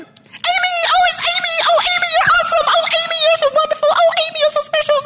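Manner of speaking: very high, loud gushing American shout for Amy; bad American accent. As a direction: shriek her praise in a hammed-up American accent. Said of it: "Very bad American accent".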